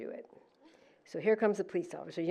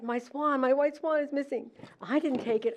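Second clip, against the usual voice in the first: higher, urgent insistence; pleading that the swan is gone; retold upset protest; 7 semitones higher than usual. To the son about the missing swan, higher and upset.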